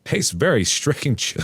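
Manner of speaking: drawn-out, very slow mock judgment; slow, wary taste verdict. After a bite of potato, a slow mock-strange verdict. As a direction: savor it like something alien. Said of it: "I made a high-pitched noise and said".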